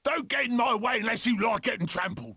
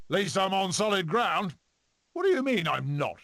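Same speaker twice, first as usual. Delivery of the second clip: usual speaking voice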